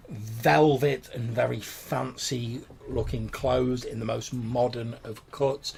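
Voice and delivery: ordinary talk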